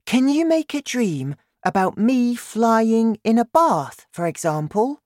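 put-on high-pitched little girl with a plan; eager girl. A narrator's reading. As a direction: put the question high and keenly, as a child. Narrator voices Sophie.

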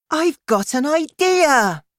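put-on very high, loud girl's exclamation; excited cry. A narrator's reading. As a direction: burst out with a bright idea, high and loud. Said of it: "she cried excitedly".

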